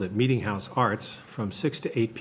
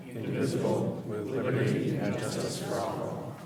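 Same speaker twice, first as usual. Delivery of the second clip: steady group recitation; reciting the pledge. Real, not acted. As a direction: recite it in unison, measured.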